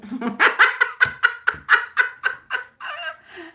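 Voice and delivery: long high laugh that falls away; a real giggling fit trailing down; loud high laughter, then settling. Real, not acted. Laughing at "weird.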